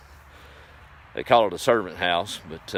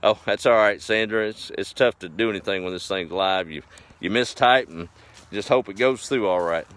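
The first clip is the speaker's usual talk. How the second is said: ordinary talk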